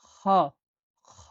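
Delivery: খ scraped rough from the back of the throat; throaty kha. Shown as a demonstration. Teacher demonstrating the Arabic letter, rasped from the throat. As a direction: rasp খ out of the throat like clearing it. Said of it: "এটা গলা দিয়ে বের করতে হবে".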